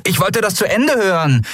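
Miko protesting. Acted. Miko objects to the cube being switched off, high protest. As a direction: object like a kid cut off. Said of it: "protestierte Miko".

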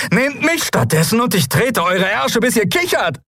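high, defiant yell; furious high-pitched challenge; angry scream. A narrator's reading. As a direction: hurl the threat high and fierce. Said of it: "schrie Lien die Schatten an".

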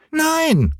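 hohe, quengelnde Kinderstimme; kleiner Junge schreit. Acted. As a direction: fordernd wie ein ungeduldiges Kind. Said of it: "schrie Häwelmann". (hoch und fordernd gerufen)

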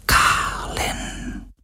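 breathless whisper of his name; soft unvoiced whisper, almost yearning. A narrator's reading. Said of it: "flüsterte er".

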